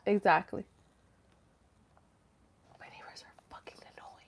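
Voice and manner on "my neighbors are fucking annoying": barely audible whisper; whispered aside. Real, not acted. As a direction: whisper a private complaint. Muttered aside about neighbors.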